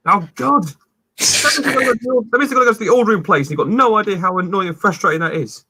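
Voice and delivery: very high, comic aggravated rant; imitated exasperation. Acts out owner's exasperated outburst.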